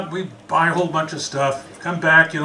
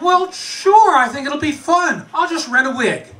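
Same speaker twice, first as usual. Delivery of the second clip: pitched up high, too cheerful; nervous overeager bluff; 8 semitones higher than usual. Retold or imitated. To roommate about the costume, nervously overeager and high. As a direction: fake confidence with a forced bright lift.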